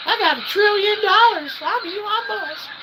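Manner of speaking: high falsetto billionaire brag; mock Elon Musk, high and smug; Elon Musk impression. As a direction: lord it over everyone in a squeaky high voice. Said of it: "Way to go, Elon Musk".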